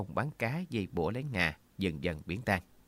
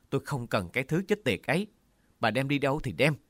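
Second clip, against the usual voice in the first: higher, louder angry cry; furious outburst; 6 semitones higher, 4 dB louder than usual. A narrator's reading. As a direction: fling the words at her in rage. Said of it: "Giọng chú rít lên".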